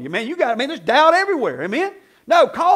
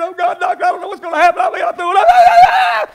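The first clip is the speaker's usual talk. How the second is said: hammed-up panicked patient, higher and louder; mock panic; 8 semitones higher, 11 dB louder than usual. Patient mock-pleading with the doctor, higher and louder panic. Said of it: "and I'm scared".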